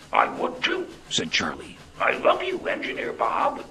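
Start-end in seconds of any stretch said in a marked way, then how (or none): 0.1-0.9 very high, whispery little voice; small gruff voice. A narrator's reading. Charlie answering his engineer friend, small gruff voice. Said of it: "in his small, gruff voice". 2.0-3.6 high, whispery little train voice; small gruff voice. A narrator's reading. Charlie declaring love to his engineer, small gruff voice. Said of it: "in his small, gruff voice".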